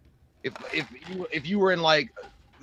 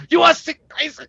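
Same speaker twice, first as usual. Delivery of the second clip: put-on high, loud drunken challenge; mock drunken yell; drunk dude; 12 semitones higher, 7 dB louder than usual. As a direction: imitate a drunk guy daring everyone. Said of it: "it's every drunk dude who's just like".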